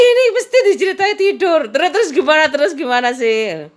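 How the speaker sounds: high, loud eager pestering; eager friend. Retold or imitated. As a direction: beg for the rest of the story, giddy. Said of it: "diceritain temen aku kayak itu".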